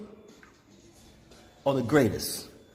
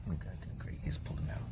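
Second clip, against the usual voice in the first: whispered aside to himself; 11 semitones lower, 16 dB quieter than usual. Real, not acted. Driver whispering to himself as a car pulls out. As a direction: drop to a breathless whisper. Muttering while driving.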